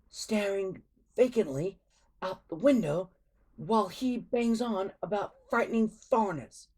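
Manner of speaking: put-on a boy listing the villain's crimes to his face; indignant Fishlegs. A narrator's reading. Fishlegs lectures Alvin.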